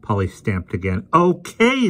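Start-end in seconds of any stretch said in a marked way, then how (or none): 1.1-1.9 high, loud angry snap. A narrator's reading. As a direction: fling it out in anger. Angry girl stamps and leaves.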